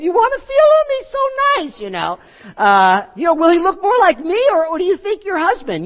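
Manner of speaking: very high, mocking put-on voice of a proud pregnant girl; gloating Hagar. Preacher mimics Hagar showing off her pregnancy to Sarai, high and smug. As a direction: flaunt it, squeaky and smug. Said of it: "I can only imagine Hagar ... saying".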